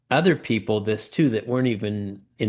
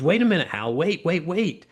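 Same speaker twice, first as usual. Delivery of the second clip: rising higher with each wait, startled; alarmed protest; 7 semitones higher than usual. Retold or imitated. Banker halting the dad before he signs, startled. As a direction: stop him mid-signature, mock alarm.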